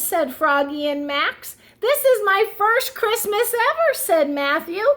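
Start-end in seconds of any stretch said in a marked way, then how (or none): 1.8-3.9 excited. Acted. Turtle's excited first Christmas.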